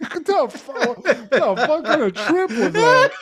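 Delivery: words squeezed out through high-pitched laughter; wheezing laugh through the question. Real, not acted. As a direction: barely get it out while cracking up. Cracking up at the story.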